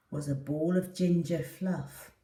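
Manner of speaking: usual speaking voice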